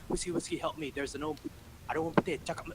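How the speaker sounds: high-pitched flustered colleague; panicked plea. Retold or imitated. Colleague calling the supervisor for help, mock panic. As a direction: imitate someone begging for rescue.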